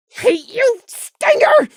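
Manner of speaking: very high, raw cry of hate; furious shout. Acted. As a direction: scream it at the enemy. Prey furious at the dead stinger.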